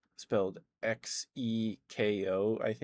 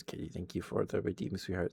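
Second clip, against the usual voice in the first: ordinary talk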